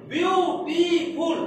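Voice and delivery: "Beautiful" very slowly as বিউ, টি, ফুল; three separate beats. Shown as a demonstration. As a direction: stretch each syllable of "beautiful", pausing between. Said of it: "এইখানে আবার তিনটা ঝোঁক বা শাসা লাগছে".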